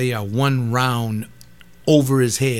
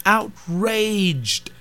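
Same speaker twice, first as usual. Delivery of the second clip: outraged again, higher and falling hard; indignant outraged, high then falling; outraged, pitched up; 8 semitones higher than usual. Real, not acted. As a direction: climb up on outraged, then let it drop. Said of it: "and I get outraged".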